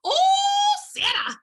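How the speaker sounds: loud, high, delighted shout of her name; excited shout. Retold or imitated. Uncle booming her name on the phone, delighted. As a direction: call out her name with big joy. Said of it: "and he's hilarious".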